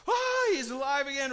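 high, loud joyful shout of the crowd; joyful cheer. Retold or imitated. Crowd cheering as Lazarus walks out, a joyful shout.

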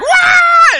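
long, loud, very high whoop; hammed-up wild yell of release; excited scream. Everyone finally unstuck after the pandemic, high exaggerated excited scream.

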